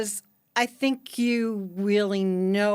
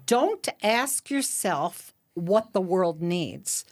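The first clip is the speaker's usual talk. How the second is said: slow quote reading; slower than usual. Acted.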